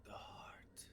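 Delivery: breathy ghostly plant voice; eerie whisper. Acted. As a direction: whisper it like a dying thing. Hedge answers in eerie whisper.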